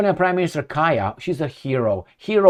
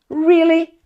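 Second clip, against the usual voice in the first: high mocking "Really?", much higher and louder; sarcastic disbelief; 12 semitones higher, 4 dB louder than usual. Real, not acted. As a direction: scoff at it in disbelief. Scoffing at prime minister's claim.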